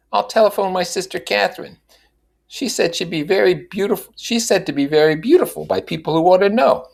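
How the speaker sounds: high, eager Mrs. Wilson voice; earnest. A narrator's reading. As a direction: pitch it up and plead sincerely.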